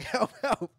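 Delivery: high, loud imitated cries; mock scream for help. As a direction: pitch the pretend help calls way up. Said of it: "screaming out".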